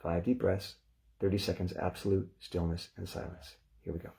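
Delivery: calm, measured breathing instructions; guided breathing cue. Acted. Meditation teacher guiding the group, calm and measured. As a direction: talk them into the breath gently.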